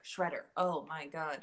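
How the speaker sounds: normal talking voice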